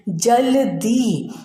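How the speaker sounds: जल्दी stretched with a full ल, very slow; wrong pronunciation, slow. Shown as a demonstration. Teacher shows pupils how not to say it, slow. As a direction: drag out जल्दी as the wrong form. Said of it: "तो हम कैसे बोल रहे हैं?".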